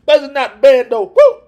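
high, loud cheering woo; excited whoop. Real, not acted. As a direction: whoop it up in delight. Cheering a good shot count.

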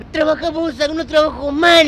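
loud, high retold quitting rant; angry outburst. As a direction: throw the job back at him, loudly. Retold furious quitting on the job. (renuncia airada a los gritos)

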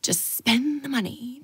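slow whisper to herself; whispered self-talk. Retold or imitated. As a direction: soothe yourself under your breath. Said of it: "I was like".

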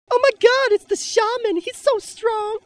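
high squealing mock fangirl voice; swooning fan girls. Mocking fans swooning over the Shaman, high squealing gush. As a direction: gush like an awestruck girl, way up high. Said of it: "they'll be like".

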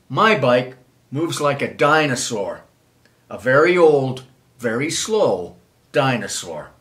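usual speaking voice

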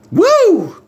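high, loud joyful whoop; excited whoop. Real, not acted. Preacher whooping for joy over a saved sinner. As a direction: let out a whoop of delight.

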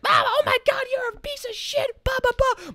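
very high squealing mock parent outrage; yelling parents. Angry parents yelling at him in the audience, high squeal. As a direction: shriek like an outraged parent mob. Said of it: "The parents all start yelling at once".

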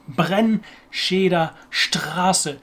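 "Brennscheder Straße" slowly, word parts drawn out to show the R's; German R practice. Shown as a demonstration. Learner showing the sentence used for training, slow German R practice. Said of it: "train myself to pronounce this German R".

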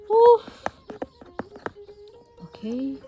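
ordinary delivery

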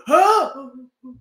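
loud, exaggerated gasp; imitated jump-scare gasp; mock startled gasp. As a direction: gasp sharply to mimic someone jumping. Re-enacts the other's startled jump.

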